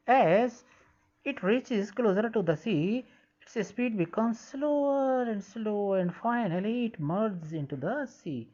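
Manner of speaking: slow, careful read-aloud of English text; slow textbook reading. Shown as a demonstration. Teacher reading the textbook line slowly to pupils. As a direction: read each word out slowly for the class.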